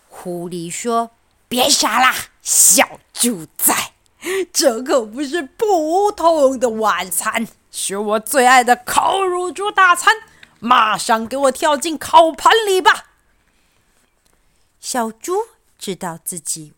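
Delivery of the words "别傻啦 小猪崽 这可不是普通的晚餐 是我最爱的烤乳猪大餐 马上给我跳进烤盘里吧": loud, gloating villain fox; bossy fox. Acted. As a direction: boss the piglet around. Fox bullies piglet into roasting pan.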